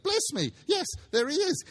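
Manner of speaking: high and eager; thrilled to see him again; delighted. A narrator's reading. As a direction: Scrooge pointing out Dick to the ghost, excited. Scrooge delighted at old friend.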